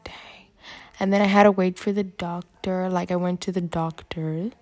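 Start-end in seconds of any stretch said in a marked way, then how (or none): 0.0-0.5 barely audible, breathy dang; hushed dang. Retold or imitated. Retelling the nurse checking her temperature, hushed dang. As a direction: drop almost to nothing on the nurse's dang.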